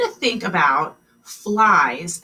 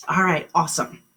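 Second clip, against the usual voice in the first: ordinary delivery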